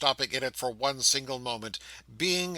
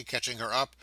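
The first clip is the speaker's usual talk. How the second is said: usual speaking voice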